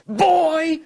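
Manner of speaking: high, loud imitated shout of Boy!; mock yell. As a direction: bark the word up like a mocking yell. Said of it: "He's yelling".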